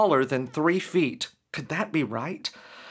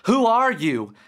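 raised, louder insistent question; insistent demand; 7 semitones higher, 4 dB louder than usual. Acted. Derek confronting the creature at his bed, insistent raised voice. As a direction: push back at the intruder, voice up. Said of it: "insisted Derek".

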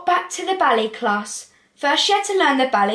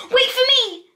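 high, loud little squeak; 12 semitones higher, 4 dB louder than usual. A narrator's reading. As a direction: squeak it out like a small rabbit. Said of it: "squeaked Lettuce".

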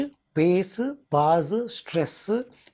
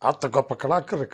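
slow, drawn-out film-star impression; Akkineni Nageswara Rao; 4 dB louder than usual. Shown as a demonstration. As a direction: stretch the phrases like a veteran actor's speech. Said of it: "నా అక్కినే నాగేశ్వరరావు చెప్పాలంటే".

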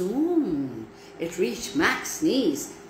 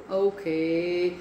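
usual speaking voice

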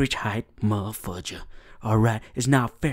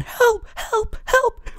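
very high, crying yelps of help; hammed-up high panicked cries; mock scream for help; much slower than usual. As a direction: squeal it like a panicked kid. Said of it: "will be screaming".

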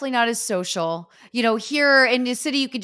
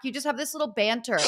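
normal talking voice

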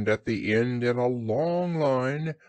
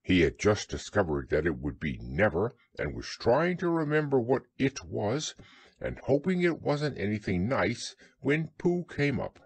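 ordinary delivery, nothing marked

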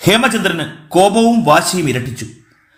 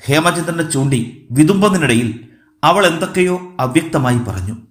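ordinary delivery, nothing marked

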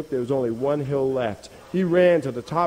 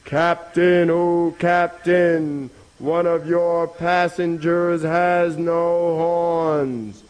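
high, long-drawn singsong hail; calling out; 4 semitones higher, 3 dB louder, much slower than usual. Acted. Rabbit hailing the captain from the hill, calling out. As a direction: stretch every word across the water. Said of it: "the rabbit called out".

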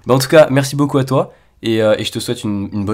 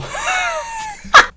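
high shrieking laugh; embarrassed laugh. Real, not acted. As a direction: let out a high squeal of laughter.

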